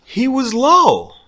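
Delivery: ordinary delivery, nothing marked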